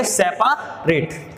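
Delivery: सेपरेट stretched slowly into se-pa-ret, the middle a sounded; slow spelling pronunciation. Shown as a demonstration. Teacher showing students the memory pronunciation. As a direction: drag out सेपरेट syllable by syllable. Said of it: "लेकिन आप याद क्या करेंगे".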